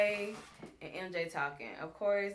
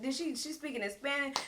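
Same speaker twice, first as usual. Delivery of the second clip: ordinary delivery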